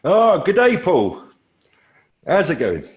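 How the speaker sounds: loud, high-pitched mock-Aussie greeting; attempted Australian accent. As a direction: greet him in your best Australian accent. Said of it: "my best attempt to an Australian accent".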